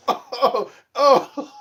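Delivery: high giggly oh oh through laughter; laughing. Real, not acted. Vlogger laughing at his own scowl impression.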